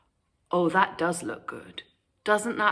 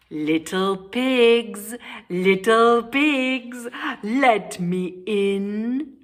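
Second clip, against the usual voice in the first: slow, louder wolf roar; 3 semitones higher, 5 dB louder, slower than usual. Acted. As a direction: roar it slowly. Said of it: "roared the wolf".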